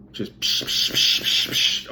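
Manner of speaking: unvoiced psh psh for water splashing; imitating arms slapping the water; splash noises. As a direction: spit out splashy psh sounds.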